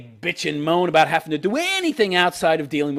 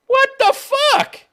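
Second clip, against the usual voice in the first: high, slow, stretched-out outrage; high, slow outraged what the fuck; outraged disbelief; 6 dB louder, much slower than usual. Real, not acted. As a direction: drag it out in disbelief. Outraged reaction.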